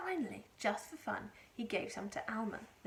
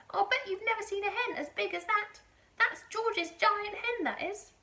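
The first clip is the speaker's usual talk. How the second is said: put-on high, loud crowing old woman; boastful Grandma; 12 semitones higher, 8 dB louder than usual. A narrator's reading. Grandma boasting to Mary from the roof, loud and high. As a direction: brag about the giant hen. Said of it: "Grandma shouted".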